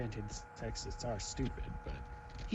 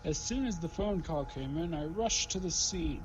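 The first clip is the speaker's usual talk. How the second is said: higher put-on detective voice; detective character voice; 5 semitones higher than usual. Detective beginning testimony to the court, higher put-on voice. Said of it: "remember the voice I did".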